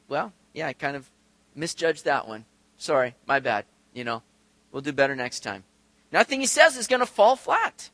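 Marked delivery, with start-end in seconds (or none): 0.1-5.6 low, soft, offhand excuse; mock sheepish apology. Mock God shrugging off a mistake.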